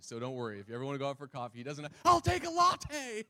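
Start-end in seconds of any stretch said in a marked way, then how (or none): 2.0-3.2 mock over-excited shout, high and slow; Pastor Tim. Preacher parodies his colleague ordering coffee, hammed-up shouting. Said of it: "He doesn't talk like that".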